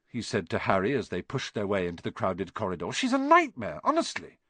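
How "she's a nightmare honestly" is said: scornful. A narrator's reading. Ron complaining.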